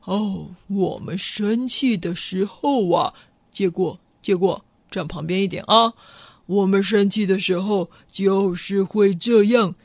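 low rhino explaining; a gruff rhino getting ready to charge; the rhino shows the lion what rhinos do when they're angry. Acted. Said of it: "犀牛就说".

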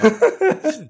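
bursting high laugh; sudden loud laugh at a joke. Real, not acted. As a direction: let out a big real laugh.

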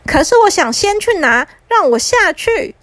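put-on high child's insistent demand; pleading little brother. Little brother begging the magician to let him down, high and insistent. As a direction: beg in a high voice, stretching the end. 弟弟要求魔法师放他下去.

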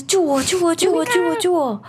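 high, pleading calls of save me; desperate cry for help. Acted. A panicked man running and begging for help. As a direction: beg for rescue while running. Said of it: "崩溃的在喊".

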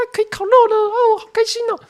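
very high, loud sing-song mockery; mocking girly voice. Mocking the girls strolling out with cola, very high sing-song. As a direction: ham up the girls' fake cheer.

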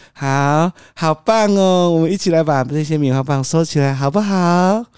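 put-on high, loud, bright baby-talk cheer; forced cheer. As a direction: force a happy tone through frustration. Father forced cheerful coaxing toddler. (对小孩强装开心，高声哄)

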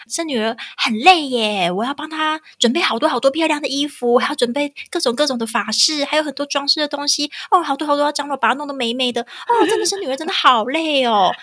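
high, fussy complaining relative. Retold or imitated. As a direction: whine about the work of a daughter, high. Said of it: "那个亲戚就聊天聊，聊，聊，然后就说".

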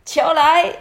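high, loud warning call; demonstrated golf shout. Shown as a demonstration. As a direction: call out that it's coming. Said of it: "你要喊什么？".

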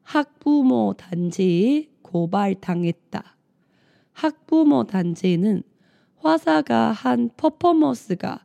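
loud, clear news read aloud. Acted. Reads news articles aloud.

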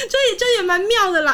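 real helpless laughter; laughing. Real, not acted. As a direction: squeeze the words out through laughter. Laughing hard after story.

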